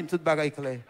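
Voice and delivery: very low, soft delivery; subdued report of the outcome; quiet retold news. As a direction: say it low and hushed. Doctor's news, first take.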